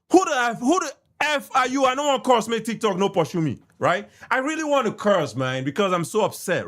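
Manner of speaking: ordinary talk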